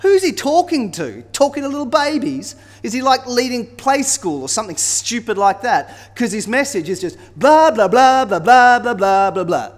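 high, loud mocking taunt; drunken jeering. Drunken leaders ridiculing Isaiah's message, jeering loudly. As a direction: jeer at him, building into loud babble. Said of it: "they're all jeering and drunkenly mocking him".

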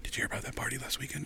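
hushed, quick whisper; super nervous. Retold or imitated. Agent sidling up to comic before the show, nervous whisper. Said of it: "super nervous".

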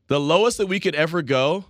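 ordinary talk, nothing marked

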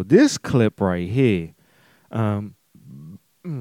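talking through a burp; words pushed out on a burp. Real, not acted. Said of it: "it's another burp".

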